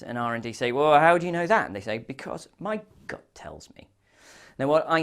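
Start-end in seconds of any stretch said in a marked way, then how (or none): 0.7-1.7 loud, high-pitched challenge; mock-incredulous questioning; acted challenge. R and D challenge marketing. 2.0-3.9 soft, half-whispered answer; quietly acted confession; hushed punchline. Quoted marketer's punchline answer.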